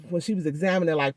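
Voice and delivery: ordinary delivery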